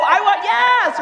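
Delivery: loud, high, emphatic yes; excitement. Shown as a demonstration. Speaker showing the audience how to respond, excited. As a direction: cheer it out to the crowd. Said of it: "Like that".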